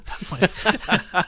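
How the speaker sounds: laughing through the word; a real, high giggle. Real, not acted. Laughing at [name] question.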